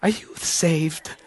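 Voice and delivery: soft, slow put-on church lady. Stranger checks on a visitor's salvation, soft church-lady voice. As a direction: draw it out softly in a put-on voice. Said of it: "I don't know why I do that voice".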